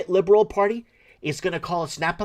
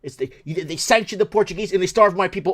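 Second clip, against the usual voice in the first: ordinary delivery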